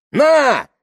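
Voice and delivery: high, loud, furious yell; screamed. A narrator's reading. Tamara pulling a knife on the crowd, screamed. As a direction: fling the word out in a scream. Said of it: "закричала Тамара".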